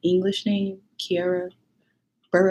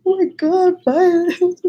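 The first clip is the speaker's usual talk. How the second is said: much higher, louder amazed exclamation; teacher's amazed cry; 13 semitones higher, 5 dB louder, much slower than usual. Retold or imitated. The teacher seeing the trophy, a high amazed cry. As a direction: gush it out like a thrilled teacher. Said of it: "she was crying".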